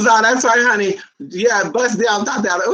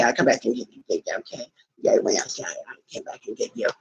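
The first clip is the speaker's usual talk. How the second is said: soft unvoiced whisper, much lower; whisper to the dog; 11 semitones lower, 6 dB quieter than usual. Real, not acted. To her dog, a soft tender whisper.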